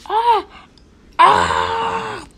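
loud, high "ah" screams; pretend scream. Character being attacked, loud pretend screams.

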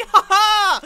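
high, loud triumphant ha-ha; gloating laugh. Real, not acted. Player crowing over beaten players, gloating laugh. Gloating after winning a fight.

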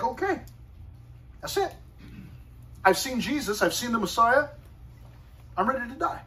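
high-pitched, lightly voiced contented old believer; acted eager readiness. Simeon, having seen the Messiah, ready to go, eager.

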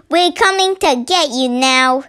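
ordinary delivery

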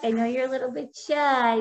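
normal talking voice

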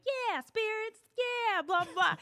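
high, dismissive mimicry; mimicking eager lady. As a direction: parrot her up high, brushing it off. Mimicking the lady's eager reply.

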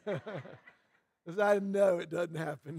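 talking through a soft chuckle; low, slow, amused and laughing as you speak; self-mocking chuckle under the words. Real, not acted. Joking to audience while laughing.

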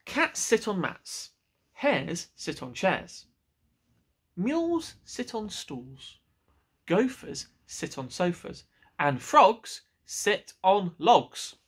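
slow, deliberate reciting of rules; know-it-all cat. Acted. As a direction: spell out the obvious rules like a smug cat.

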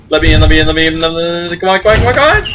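loud, rapid frantic begging; desperate to get through the door; real panic at the door; panicked pleading. Real, not acted. Banging at door, chased.

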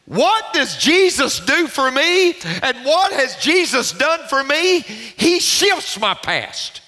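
high, ringing preacher delivery; impassioned preaching. Real, not acted. Preacher urging a congregation toward new life, impassioned. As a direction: preach it out at full pitch.

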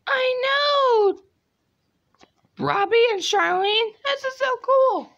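put-on excited squeaky kid voice.

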